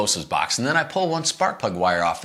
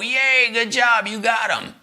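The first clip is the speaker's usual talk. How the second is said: mock cheer; 13 semitones higher, slower than usual.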